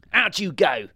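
high, loud order; robber baron's shout. A narrator's reading. Robber baron evicting king and queen, shouting. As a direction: shout them out of their home. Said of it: "he shouted".

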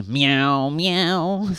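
high, drawn-out sorry meow; woeful storybook cat; pitiful cat. A narrator's reading. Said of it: "agreed Hamish pitifully".

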